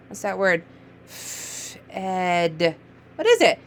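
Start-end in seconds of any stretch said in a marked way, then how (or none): 1.0-2.7 Fed softly drawn out with a long f; slid sounds. Shown as a demonstration. Teacher repeating the slide for a reader, Fed drawn out. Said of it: "Can you slide it?".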